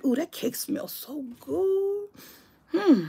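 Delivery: soft, slow, delighted aside; sudden quiet aside about cake smell, savoring. Real, not acted. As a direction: breathe in the smell softly. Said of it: "that cake smells good".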